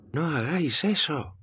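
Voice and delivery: high-pitched shouted plea to stop; grito. A narrator's reading. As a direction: call out high, as if over a crowd. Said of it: "grita Jimena". (grito agudo)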